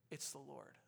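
very soft, low realization; quiet, low acted reveal; hushed recognition. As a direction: say it under your breath in awe. Peter recognises Jesus on the shore.

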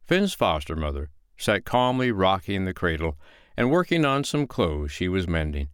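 ordinary delivery

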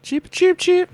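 high chirpy cheeps; cartoon bird voice. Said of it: "Oh, bird noises".

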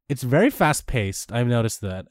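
usual speaking voice

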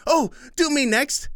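put-on high bouncy child voice; eager little girl; excited kid. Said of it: "Kim said as he, she bounced".

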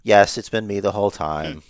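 low, quiet, matter-of-fact confession; lightly voiced smug office guy, coolly owning up; matter-of-fact worm. Imagined office villain confessing to Sami Zayn, lightly matter-of-fact. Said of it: "I can imagine him matter-of-factly talking to Sami Zayn".

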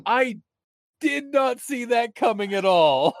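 shouting in real excitement; excited shout. Real, not acted. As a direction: let it burst out, amazed. Reacting to plot twist.

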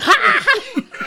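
high, loud villain cackle; shrieking mad cackle; maniacal Joker cackle. Acted. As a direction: burst into a crazed, high-pitched laugh. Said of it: "in the Cesar Romero voice".

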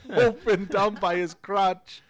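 loud, high, drawn-out storytelling through laughter; laughing climax. Retold or imitated. Dog owner telling how cousin broke the chair, laughing. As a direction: build it big, cracking up as you go.

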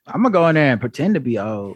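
put-on low, thin old man voice; very old faint voice. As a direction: play a frail old man. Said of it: "You're messing up my bit".

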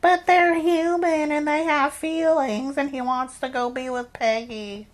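high, loud sarcastic parody voice; mock a sappy superhero plea. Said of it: "stupid modern Marvel shit".

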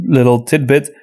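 ordinary talk, nothing marked

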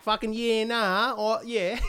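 lightly put-on Queensland accent; broad Queensland bloke, half joking. Shown as a demonstration. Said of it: "I get my Queensland accent back".

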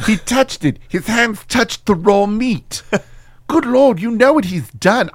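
outraged posh Brit; fussy upper-class disgust. Acted. Q outraged over his sandwich.

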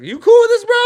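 very high, loud blurt; mock shouted check. Guest checking with the watching husband, mock incredulous shout. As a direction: burst out with an incredulous question.

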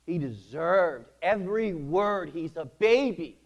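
high, loud, drawn-out insistence; re-enacted heated defiance; indignant protest. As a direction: hammer each word in stubborn self-justification. Acted stubborn argument with God.

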